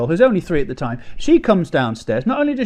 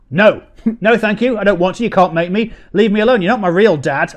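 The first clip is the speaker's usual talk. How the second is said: put-on louder, higher childish refusal; mock panic; 5 semitones higher, 5 dB louder than usual. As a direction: whine like a sulky child. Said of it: "I was panicking at this point".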